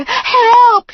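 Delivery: high, loud cry for help; yelling for help. A narrator's reading. Girlfriend yelling for help, loud and high. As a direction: scream it to the neighbours. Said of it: "she yelled".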